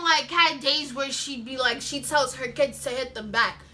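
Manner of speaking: ordinary delivery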